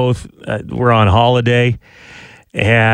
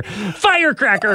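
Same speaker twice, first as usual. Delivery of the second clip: higher-pitched mock yell of a firework; imitated yell; 7 semitones higher than usual. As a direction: mimic a man yelling fireworks noises. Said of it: "He's just yelling firecracker".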